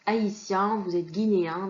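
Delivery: normal talking voice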